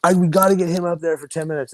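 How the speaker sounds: high-pitched retold pleading for a friend; insistent hype. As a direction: mimic pals pushing hard for him. Friends insist he gets stage time.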